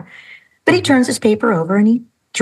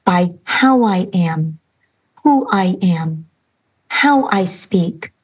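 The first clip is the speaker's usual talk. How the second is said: usual speaking voice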